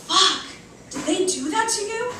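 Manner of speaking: high, startled swear then question; shocked outburst. Acted. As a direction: recoil at the sight of the bruises. Seeing the bruises.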